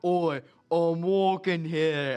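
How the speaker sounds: put-on loud, slow New York street-tough accent; New York tough guy. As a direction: bark it like a New Yorker shoving past, drawn out. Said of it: "he bumps shoulders like".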